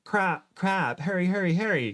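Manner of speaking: usual speaking voice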